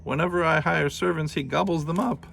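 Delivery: high-pitched giantess voice; complaining giantess. Acted.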